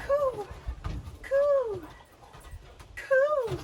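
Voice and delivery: high cooing dove. Shown as a demonstration. Teacher cooing like a dove for the kids. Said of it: "Let's fly like a dove".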